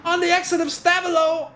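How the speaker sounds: high, loud shouting commentator; excited commentary. Real, not acted. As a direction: erupt as the race leader slips. Leader goes wide on hot lap.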